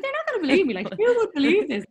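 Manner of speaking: bursting with news; imitating her excited self about telling friends; excited disbelief. Excited retold thought.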